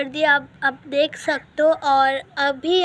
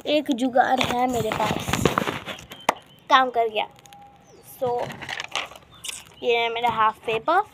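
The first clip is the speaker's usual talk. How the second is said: ordinary talk, nothing marked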